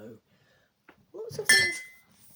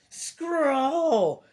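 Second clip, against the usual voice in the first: high, loud creature screech; 6 semitones higher, 6 dB louder than usual. A narrator's reading. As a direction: screech out an imitation Bigfoot call. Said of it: "she screeches".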